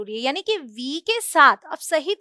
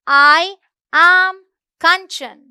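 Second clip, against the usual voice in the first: "I am Kanchan" slow and louder, word by word; slow model sentence; 4 semitones higher, 9 dB louder, much slower than usual. Shown as a demonstration. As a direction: spell it out slowly for learners. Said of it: "तो मैं क्या बोलूंगी".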